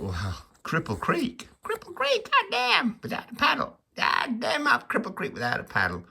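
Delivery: high strained character voice; hammed-up exclamation. Man replaying his thought about being stuck, hammed-up high voice. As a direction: play the stricken reaction, voice pitched right up.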